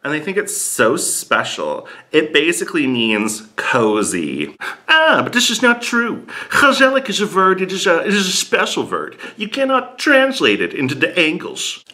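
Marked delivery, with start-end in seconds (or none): 4.9-11.9 put-on high-pitched, protesting Dutch accent; mock Dutch English, pitched up. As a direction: object in a higher, heavily Dutch-accented voice. Acts out a Dutch person objecting.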